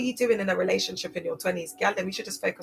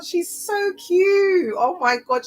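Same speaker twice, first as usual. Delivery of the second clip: much higher, slow, doting coo; 11 semitones higher, 4 dB louder, much slower than usual. Real, not acted. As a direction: mum cooing fondly about her daughter. Cooing over the child.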